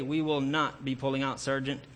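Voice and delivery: normal talking voice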